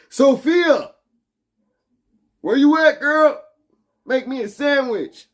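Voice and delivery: high, loud mock yell for Sophia; loud, high mock summons across the office; calling out to a robot. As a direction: holler up for the robot coworker. Calling out to an AI coworker.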